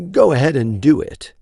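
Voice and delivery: lightly voiced, smooth and friendly; professionally friendly. A narrator's reading. Johnson urging Hale on, lightly professional friendliness. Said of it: "Johnson's professionally friendly voice said".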